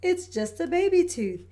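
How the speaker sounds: high, gentle mum voice; calm her like a kind mum; reassuring mother. Acted. Mummy Pig reassures Peppa.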